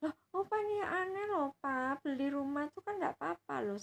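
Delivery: put-on annoyed child voice. Granddaughter insists to grandpa he may buy it. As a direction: whine it like a fed-up kid.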